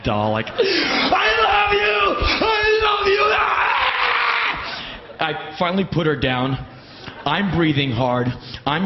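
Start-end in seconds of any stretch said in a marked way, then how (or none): 0.5-3.2 high, drawn-out ecstatic scream; psycho hero worship. Retold or imitated. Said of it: "I was a five-year-old meeting my super-duper". 3.3-5.2 long high-pitched shriek; ecstatic scream. Retold or imitated. Climax of screamed worship in audition.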